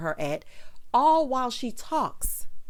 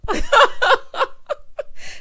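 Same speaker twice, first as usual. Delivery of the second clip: high, loud peal of laughter; laughing; 8 dB louder than usual. Real, not acted. Host amused by the grill line, laughing. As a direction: crack up helplessly at your own bit.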